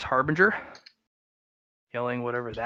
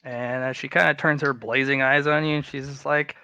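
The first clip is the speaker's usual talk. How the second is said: usual speaking voice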